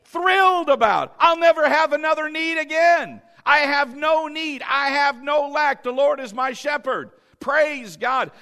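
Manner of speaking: loud high preaching shout; full-force preacher's cry; excited shout. Acted. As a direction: shout it to the rafters with joy.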